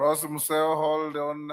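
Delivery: calling out. Real, not acted. Speaker calls member to stop.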